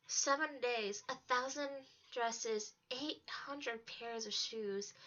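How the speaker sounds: usual speaking voice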